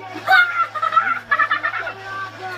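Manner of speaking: hearty high laugh burst; high loud cackle. Real, not acted. Player laughing at own mistake, loud cackle.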